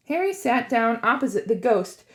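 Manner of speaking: plain reading voice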